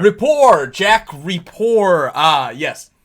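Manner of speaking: loud, high, drawn-out name; Mister Streeter. Acted. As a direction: exclaim the name as if just remembered. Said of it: "He says".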